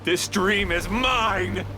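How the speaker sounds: slow, high, defiant claim; possessive declaration. Acted. Villain defying the heroes, slow high proclamation. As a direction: stretch it out as a villain's boast.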